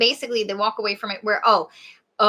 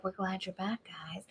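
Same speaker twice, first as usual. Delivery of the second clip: very soft voice fading to whisper; hushed greeting; 14 dB quieter than usual. Retold or imitated. Trees welcoming friends back, hushed. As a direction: let the greeting fade into a whisper.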